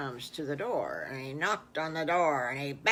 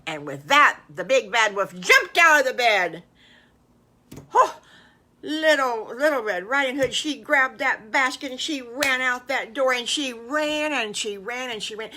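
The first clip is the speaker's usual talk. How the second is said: much louder, racing storyteller; thrilling chase narration; excited chase; 6 semitones higher, 11 dB louder than usual. A narrator's reading. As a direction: tell the escape breathlessly loud.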